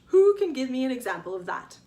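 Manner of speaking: loud, projected classroom question; declaiming teacher. A narrator's reading. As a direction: call it out to the whole class. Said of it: "Mister Zuck declaimed".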